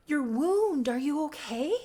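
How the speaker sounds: high, loud, worried outburst; alarmed concern. Retold or imitated. Charlotte to wounded Leif, acted alarm. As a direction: fuss over an injury in a high voice.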